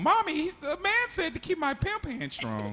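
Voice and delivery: put-on high childlike tattling voice; little girl voice. Little girl tells her mother what the man said, high and childlike. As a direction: play a ten-year-old telling mommy. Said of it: "Then she goes back home".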